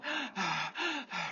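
long breathy sigh out; exercise sigh. Shown as a demonstration. Runner showing the breath during exercise, long sigh. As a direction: let a tired sigh go. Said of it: "when we're exercising and you're like".

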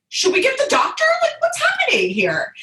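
high-pitched mock alarm; squeaky, worried urgency; anxious questioning. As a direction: imitate a flustered partner, voice way up. Voices anxious partner asking for doctor.

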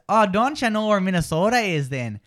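Minnesota accent with long O's; Upper Midwest accent. Shown as a demonstration. Host demonstrates an Upper Midwest accent. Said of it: "Upper Midwest".

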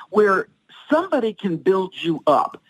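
ordinary talk

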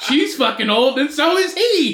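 high, loud laughing delivery. Real, not acted. Joking to friend about old royals, laughing loudly. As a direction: laugh it out, amused.

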